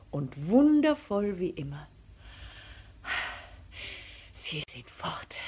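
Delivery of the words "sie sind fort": soft, breathy gasps of relief; gasping whisper. A narrator's reading. Elephant telling Paul they are gone, gasping whisper. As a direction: pant it out under the breath. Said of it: "jappte er".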